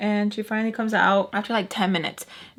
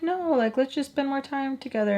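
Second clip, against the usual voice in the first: higher-pitched wheedling voice; mock sweet pleading; 5 semitones higher than usual. Sam asking Mister Peach for more time, mock sweet. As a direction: imitate a girl coaxing, pitched up.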